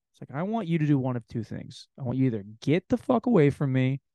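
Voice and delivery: usual speaking voice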